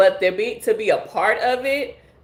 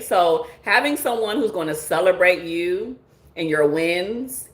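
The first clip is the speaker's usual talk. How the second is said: normal talking voice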